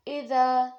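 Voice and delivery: اذا with the final ا held one alif long; alif madd. Shown as a demonstration. Teacher showing the alif madd on اذا. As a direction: stretch the ا of اذا a little. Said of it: "الف مدہ بن جاتا ہے".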